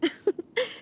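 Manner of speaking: a short, high, amused laugh. Acted. The Shogun laughs at the Traveler's boldness. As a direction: laugh down at the challenger.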